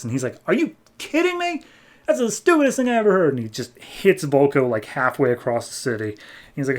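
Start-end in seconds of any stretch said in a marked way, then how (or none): 0.5-3.3 much higher, squeaky incredulous outburst, faster at the end; outraged disbelief. Retold or imitated. Aquaman scoffing at Vulko's excuse, high outraged squeak. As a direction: shriek it in mock disbelief, then rattle off the insult.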